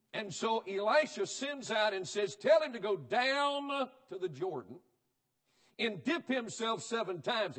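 loud, high-pitched pulpit preaching; fiery revival sermon delivery; full-force preaching. Acted. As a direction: preach it hard to the congregation.

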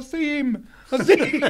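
high, giggling mock reassurance; laughing while humouring him. Real, not acted. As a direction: play along while cracking up. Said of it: "I'm wetting myself laughing".

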